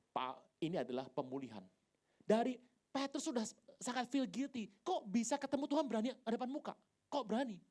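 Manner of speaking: ordinary talk, nothing marked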